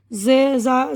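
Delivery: ordinary delivery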